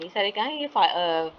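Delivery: ordinary delivery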